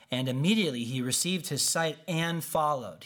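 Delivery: usual speaking voice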